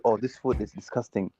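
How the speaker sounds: ordinary talk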